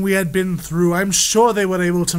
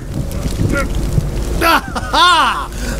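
gleeful chuckle; 7 semitones higher than usual. Acted. Villain delighted with his escape.

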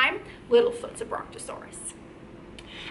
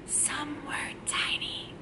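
whisper; 13 dB quieter than usual. Shown as a demonstration. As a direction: whisper it as if tiny. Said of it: "We gotta whisper that part".

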